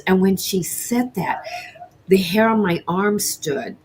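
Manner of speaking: normal talking voice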